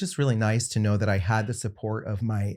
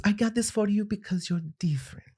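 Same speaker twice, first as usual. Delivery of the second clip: hushed, higher grandma voice; lightly teasing grandma; 9 semitones higher, 4 dB quieter than usual. Retold or imitated. Grandmother handing grandson a gift, hushed high old-lady voice. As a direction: half-whisper it high, as a little old grandmother.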